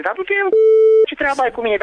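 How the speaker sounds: yelled high and loud at the caller; angry shout. Real, not acted. Accused neighbour yelling back at the caller angrily. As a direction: snap back, furious and loud.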